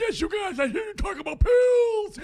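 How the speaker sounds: high, loud zombie shout. Pretend. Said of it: "Can we get you to just do the zombies".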